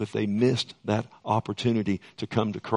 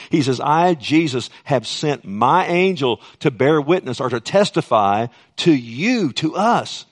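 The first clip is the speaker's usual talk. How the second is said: louder, raised preacher's proclamation; emphatic preaching; 3 semitones higher, 6 dB louder than usual. Real, not acted. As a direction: proclaim the verse to the congregation. Preaches the verse with force.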